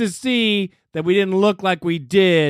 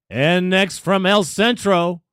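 normal talking voice

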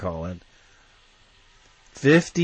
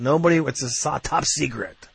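ordinary delivery

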